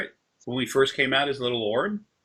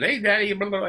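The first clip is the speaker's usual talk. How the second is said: a hint of a pet calling her daddy, higher; lightly voiced dog; 7 semitones higher, faster than usual. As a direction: lightly imitate a dog greeting Daddy, pitched up. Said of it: "she came out and she said".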